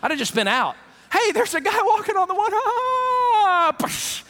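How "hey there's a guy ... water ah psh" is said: high, hammed-up frightened cry; high mock shriek then fade; mock panic. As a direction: play a disciple freaking out. Panics at man on water.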